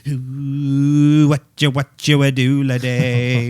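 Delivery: loud, slow spooky sing-song. Pretend. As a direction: croon it like a silly ghost.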